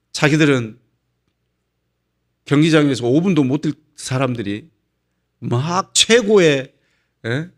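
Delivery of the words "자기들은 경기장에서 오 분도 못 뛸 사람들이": ordinary delivery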